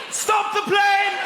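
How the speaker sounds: high, loud, drawn-out shout; mock panicked shout. Passenger without ticket, mock panicked shout. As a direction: yell it like the world is ending.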